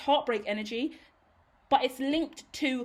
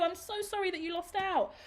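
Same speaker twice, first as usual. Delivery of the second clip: normal talking voice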